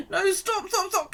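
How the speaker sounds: high, urgent no stop; high pleading stop stop; retold pained plea. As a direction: beg her to stop, it hurts. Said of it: "'cause it really hurt".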